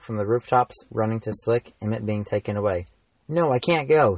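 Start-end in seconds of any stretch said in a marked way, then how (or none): 3.3-4.2 a raised, higher cry of protest; mild shout, voice pushed up; struggling and shouting he can't go. A narrator's reading. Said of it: "he shouted".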